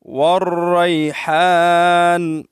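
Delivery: والریحان very slowly as "war-rayhaan", long aa; correct recitation. Shown as a demonstration. Teacher repeating the right reading, slow chanted articulation. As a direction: chant والریحان with rolled r and throaty h. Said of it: "والریحان نہیں پڑھیں گے".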